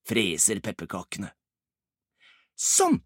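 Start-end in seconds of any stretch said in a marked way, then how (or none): 2.5-3.0 short, high-pitched exclamation; brisk done-it exclamation. Acted. Ibbi has dumped the burning tray, exclaiming briskly. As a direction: pop it out high, job done.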